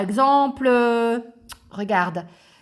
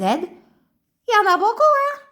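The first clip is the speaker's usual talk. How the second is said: high, bouncy little puppet voice; high childlike puppet greeting the class; 11 semitones higher, 6 dB louder than usual. Acted. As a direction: play the cheeky puppet pupil. Ratoun puppet talking.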